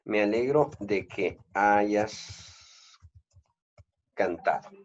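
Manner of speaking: very slow, word-by-word example sentence; slow dictation. Shown as a demonstration. Teacher slowly dictating the example sentence to the class. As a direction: spell out "Me alegro de que hayas cantado" slowly. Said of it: "volvamos una vez más a mi ejemplo original".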